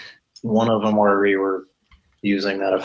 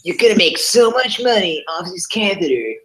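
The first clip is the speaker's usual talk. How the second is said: infomercial pitchman; 10 semitones higher than usual. Acted.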